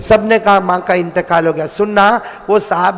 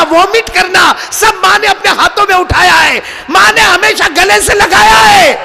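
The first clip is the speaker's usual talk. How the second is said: fiery preaching; 9 semitones higher than usual. Acted. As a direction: preach it with full force to the crowd. Preaching at full force. (जोश भरी ऊँची आवाज़ में तक़रीर)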